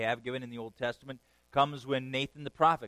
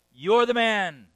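loud, higher accusing shout; accusing prophet; 8 semitones higher, 13 dB louder, slower than usual. Retold or imitated. Prophet in the king's face, loud accusation. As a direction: jab it at the king. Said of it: "point the bony finger ... and say".

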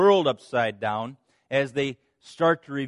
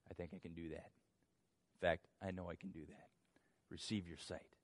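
much softer, lower and calm; gentle soft reply; 10 semitones lower, 19 dB quieter, faster than usual. Acted. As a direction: speak it quietly, kindly. Jesus gently grants the healing.

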